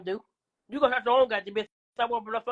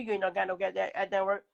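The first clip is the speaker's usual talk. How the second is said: usual speaking voice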